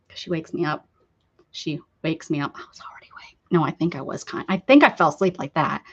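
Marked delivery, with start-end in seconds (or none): 2.6-3.4 unvoiced whisper confession; whispered aside to viewers. Real, not acted. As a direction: breathe it out as a secret.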